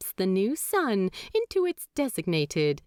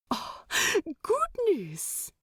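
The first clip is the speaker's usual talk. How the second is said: flustered gasp; 10 semitones higher, slower than usual. A narrator's reading. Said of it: "looks as odd".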